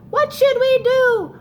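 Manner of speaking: high, loud worried cry; children crying out. Acted. Book children calling to the melted snowman, high loud cry. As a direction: call out like alarmed kids. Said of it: "The children cried out".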